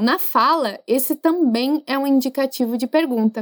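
usual speaking voice

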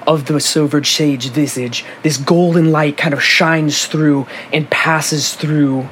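steady scene-setting narrator; story narration. Acted. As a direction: paint the scene for the players. Game master's scene setting.

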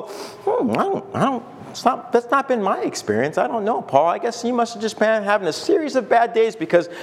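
demur politely, feeling for words; acted puzzled reluctance; mock hesitant doubt. Imagined reader doubts Paul.

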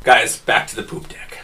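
whispered aside to the table; hushed aside; whispered joke. Real, not acted.

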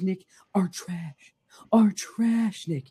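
slow breathy whisper, words drawn out; dramatic slow whisper of disdain; whispered punchline. Real, not acted. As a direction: hiss the verdict in a slow whisper. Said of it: "rant punchline".

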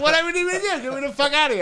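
high-pitched, loud eagerness with a laugh; desperate to help, voice leaping up; eager compliance. Retold or imitated. Acting out his eagerness to leave.

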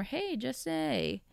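butler's greeting. Retold or imitated. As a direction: sing out the name like a butler calling a boy.

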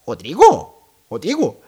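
odd little questioning calls of a name; imitated Russian strangers. Two Russian strangers asking for someone by name, imitated calls. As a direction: try the name over as a puzzled foreign stranger. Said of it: "Mas falavam assim".

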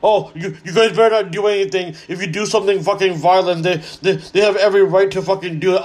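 whiny preachy voice, lightly put on; mocking imitation. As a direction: parody a scolding moderate. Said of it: "what solution do you fucking have?".

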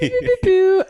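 pew sound effect. Pretend. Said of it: "I'm doing it for this podcast".

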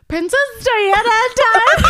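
loud, high, urgent hammed-up warning; mock dramatic warning. Warning her sister in the cab, hammed-up and urgent. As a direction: blurt it out like it's a dire omen.

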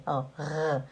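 ordinary talk, nothing marked

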